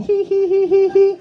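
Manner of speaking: high squeaky hee-hee giggle; a tiny high-pitched giggle, hammed up; mock giggling old man. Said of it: "I just get to laughing".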